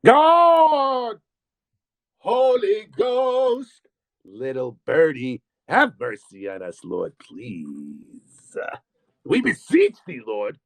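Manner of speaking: sing-song mocking church litany, very slow and drawn out; mock prayer. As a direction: ham up a pious chant to ridicule it.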